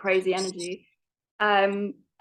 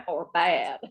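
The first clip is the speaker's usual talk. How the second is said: playful imitation of a West Virginia accent; teasing try at a Southern drawl; mimicking Southern accent; 4 semitones lower than usual. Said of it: "Hey, that was pretty good".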